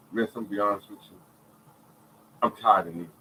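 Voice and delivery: low, subdued weary confession; understated retold despair; retold tearful breakdown. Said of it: "I'm breaking down crying".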